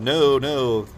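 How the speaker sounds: normal talking voice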